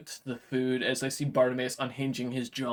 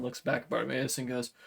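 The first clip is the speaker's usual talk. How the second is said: ordinary delivery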